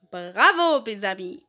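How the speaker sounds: ordinary talk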